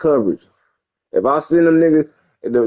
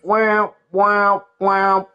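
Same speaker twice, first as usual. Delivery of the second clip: usual speaking voice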